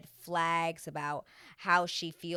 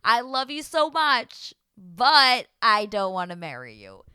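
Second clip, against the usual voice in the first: loud, drawn-out predicted let-down; hammed-up declaration, belted out; mock rejection speech; 2 semitones higher, 11 dB louder than usual. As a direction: act out a big theatrical "but". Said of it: "She's gonna do one of the".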